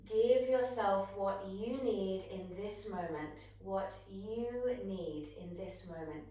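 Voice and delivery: ordinary talk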